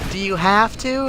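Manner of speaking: high falsetto with rising question; childish customer imitation. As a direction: ask cluelessly in the mock customer voice. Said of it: "She's like".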